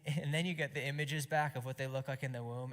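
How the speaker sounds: usual speaking voice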